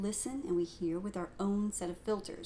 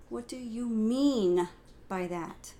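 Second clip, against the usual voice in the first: "mean" drawn out and louder, slow; stress on MEAN; 3 semitones higher, 5 dB louder, slower than usual. Shown as a demonstration. Teacher shows stress on "mean" in the question. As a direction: stretch and lean on "mean". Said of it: "depending on the emphasis that I put on each word".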